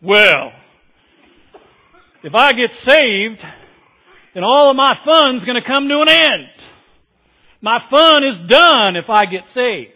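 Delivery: a hammed-up voice of someone who fears church will spoil the fun; other person voice. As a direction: play a worried skeptic afraid to lose his fun. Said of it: "I'll use my other person voice".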